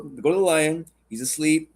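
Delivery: ordinary delivery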